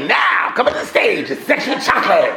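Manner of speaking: booming, hyped club MC call; confident shouted stage intro; loud MC announcement. Retold or imitated. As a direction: announce it full voice to the whole room. MC after being told to drink.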